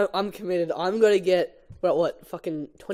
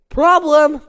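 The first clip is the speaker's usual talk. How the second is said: high, loud cry of alarm; alarmed shout; 10 semitones higher, 11 dB louder than usual. Acted. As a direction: yell it in panic. Barry stuck to the ball.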